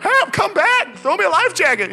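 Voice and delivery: high, loud desperate cries for help; shouting for help. Retold or imitated. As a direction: yell to a boat that can't hear you. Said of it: "I start shouting at the boat".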